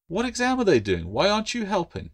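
put-on high, curious girl asking questions; a lively young girl pressing her friend. Claire questions the gecko.

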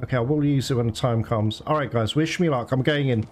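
normal talking voice

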